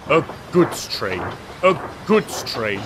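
a mild, sulky grumble; grumbling. A narrator's reading. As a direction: grumble it under your breath, lightly. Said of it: "he grumbled".

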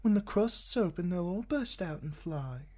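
put-on high, light young woman's voice; Shay's voice. A narrator's reading. Shay chattering to Tyrion in bed, high and eager.

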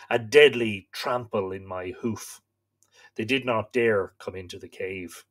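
slow, solemn storytelling read; story narration. A narrator's reading. Host reading myth to live viewers, solemn narration. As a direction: read the old myth aloud, grave and steady. Reads Irish myth aloud.

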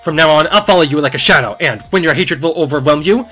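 loud gloating shadow vow; triumphant villain. Acted.

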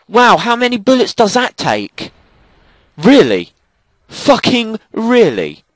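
very high, loud incredulous rage; outraged yelling. Real, not acted. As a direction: scream in disbelief at the game. Enemy survives many bullets.

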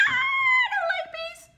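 very high, loud mock scream; frightened mom. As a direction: squeal it like a panicking mom. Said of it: "Would she say".

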